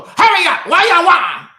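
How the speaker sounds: high, loud hammed-up shopkeeper hurrying a customer; impatient shopkeeper. Preacher imitating the Chinese store clerk rushing him, loud mock yell. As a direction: snap it like an impatient store owner. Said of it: "He go".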